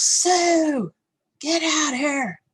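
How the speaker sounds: high, loud, drawn-out holler; imitated dad's yell. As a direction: mimic a dad hollering for his wife. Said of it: "So he yells".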